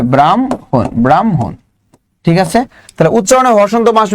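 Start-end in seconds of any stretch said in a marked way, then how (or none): 0.0-1.5 ব্রাহ্মণ as "bram-hon"; low-pitched model "bram... hon" for ব্রাহ্মণ; correct pronunciation. Shown as a demonstration. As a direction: put the m before a sounded h in ব্রাহ্মণ. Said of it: "কি উচ্চারণ হবে".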